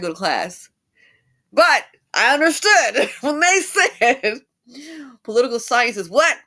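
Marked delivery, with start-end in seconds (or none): 1.5-6.4 laughing, much louder and higher. Real, not acted. Telling viewers about poli sci while laughing loudly. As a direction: laugh through the words, slow. Laughing at her own story.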